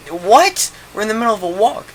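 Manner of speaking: high-pitched retold disbelief; incredulous protest. To friend falling asleep mid-walk, incredulous protest. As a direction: burst out high in bafflement.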